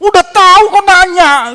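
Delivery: high, loud snappy comeback; exasperated retort. Retold or imitated. Crying wife snapping at her clueless husband, exasperated. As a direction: fire it back like a fed-up wife. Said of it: "gitu katanya".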